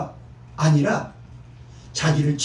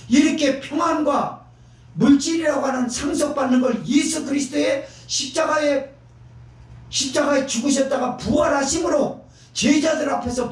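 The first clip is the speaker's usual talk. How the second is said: high, raised sermon cry; preaching at full force; 6 semitones higher than usual. Acted. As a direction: drive the point home from the pulpit. (높고 힘찬 설교 외침)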